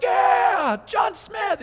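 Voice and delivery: very high, loud whooping shout; hammed-up contestant cheer; excited cheer. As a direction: run down yelling with joy. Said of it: "another guy go running down like".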